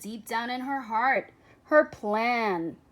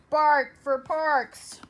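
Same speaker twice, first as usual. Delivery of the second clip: dog slogan; 2 semitones higher, 4 dB louder, slower than usual. Acted. Petition slogan voiced by pup.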